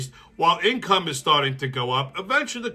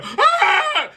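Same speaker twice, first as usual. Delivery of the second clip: very high, loud fake scream; hammed-up offended yelp; shrill pretend squeal; mock shriek; 5 dB louder than usual.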